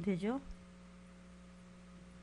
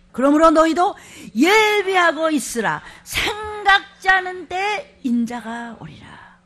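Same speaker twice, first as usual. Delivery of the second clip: loud, slow warning; solemn proclamation; 6 semitones higher, 9 dB louder, slower than usual. Acted. Pastor warning the church of the Lord's coming. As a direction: proclaim it slowly, then fade to a hush. Reading Matthew with force.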